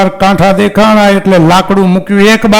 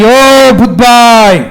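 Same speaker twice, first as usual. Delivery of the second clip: long, drawn-out call, higher and a bit louder; 4 semitones higher, 3 dB louder, much slower than usual. Acted. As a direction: call across the woods to the ghost, stretching the vowel. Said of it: "બૂમ પાડી".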